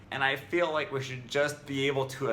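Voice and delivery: ordinary talk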